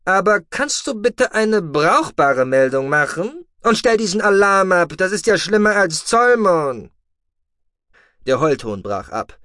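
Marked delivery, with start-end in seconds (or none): 0.1-6.9 higher, louder voice of a dry, lecturing commander; a calm officer scolding his deputy; stern commander. Acted. Commander dryly lecturing his complaining deputy, raised and higher.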